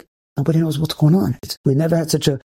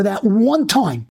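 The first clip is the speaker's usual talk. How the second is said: slow emphasis; 4 semitones higher, much slower than usual. Acted. Stressing reward of single restraint.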